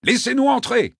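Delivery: loud, high pleading call; shouting. Acted. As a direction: shout to be let in. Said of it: "leur cria le consul".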